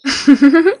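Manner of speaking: short bright giggle; little loud giggle. A narrator's reading. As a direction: let a giggle slip. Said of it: "Beatrice let out a giggle".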